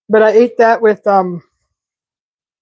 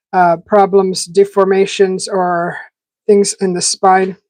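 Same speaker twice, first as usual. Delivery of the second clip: ordinary talk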